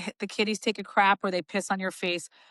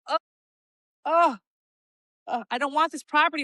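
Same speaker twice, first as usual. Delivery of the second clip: mock coy buyer; 6 semitones higher than usual. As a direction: play hard to get. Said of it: "They're like".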